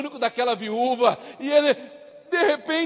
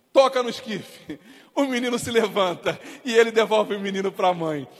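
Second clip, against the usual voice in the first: ordinary talk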